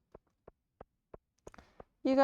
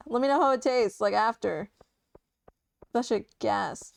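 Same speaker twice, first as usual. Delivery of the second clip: ordinary talk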